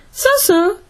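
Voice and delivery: high-pitched, modest little admission. A narrator's reading. Donovan playing down his friend's praise, modestly. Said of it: "admitted Donovan modestly".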